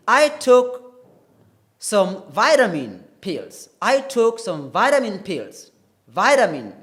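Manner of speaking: American "vitamin" said "VY-ruh-min", loud and emphatic; loud American demo of "vitamin"; American accent. Shown as a demonstration. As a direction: say "vitamin" the American way, long I and tapped T. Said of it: "more oriented towards the American way".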